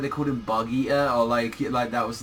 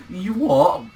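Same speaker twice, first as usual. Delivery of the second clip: high, louder outburst of disbelief; incredulous; 6 semitones higher, 5 dB louder than usual. Retold or imitated. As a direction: answer in baffled astonishment. Reacts in disbelief to nonchalant remark.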